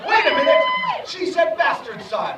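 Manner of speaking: high, loud shocked realization. Real, not acted. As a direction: gasp out the realization.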